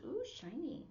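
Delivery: high, thrilled ooh over something shiny; delighted ooh. Shown as a demonstration. ASL teacher showing shiny, cooing with delight. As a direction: coo at a shiny thing.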